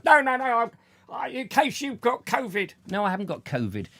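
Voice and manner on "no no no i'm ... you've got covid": mock delivery man. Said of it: "I'm going".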